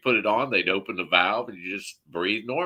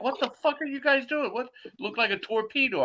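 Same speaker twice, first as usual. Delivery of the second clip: much higher, amazed and excited; incredulous question; 13 semitones higher than usual. Retold or imitated. Younger brother to the crew in the car, high incredulous squeal. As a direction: squeal it at the sight of a torpedo. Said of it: "I'm like".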